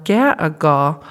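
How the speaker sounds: ga-a-gaw drawn out slowly, three babbled syllables; slow child's babble. Shown as a demonstration. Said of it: "he might pronounce it".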